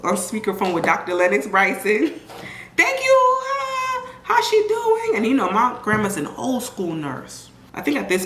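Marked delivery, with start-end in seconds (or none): 2.8-5.2 high sweet phone voice; grandma on the phone. Retold or imitated. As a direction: play grandma as the polite old-school nurse.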